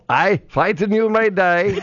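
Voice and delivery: a lightly done bad Scottish accent; William Wallace. Host slipping into a terrible Scottish accent, lightly done. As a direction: mangle it like a bad Scottish movie hero. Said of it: "that's a terrible William Wallace".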